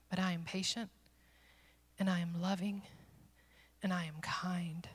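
soft, measured voice with long pauses; tenderly reassure, phrase by phrase; gentle reassurance. Retold or imitated. The Lord telling Amy He is not angry, soft and patient.

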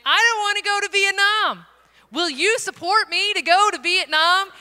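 loud, high, comic appeal; exaggerated blunt pitch. Missionary asking supporters to fund a trip she dreads, loud mock appeal. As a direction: belt out the reluctant sales pitch.

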